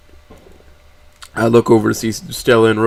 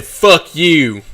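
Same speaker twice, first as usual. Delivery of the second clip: defiant curse, pitched up; raised voice; 6 semitones higher, 3 dB louder than usual. A narrator's reading. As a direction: say it out loud, defiantly. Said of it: "I begin to raise my voice".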